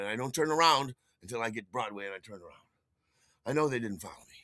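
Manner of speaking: ordinary delivery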